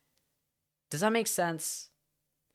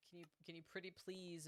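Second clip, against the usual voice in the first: very quiet, quick, higher-pitched pleading; soft plea; 4 semitones higher, 27 dB quieter, faster than usual. Real, not acted. Streamer asking viewer Kiki a favour, softly pleading. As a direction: ask very softly, almost under your breath.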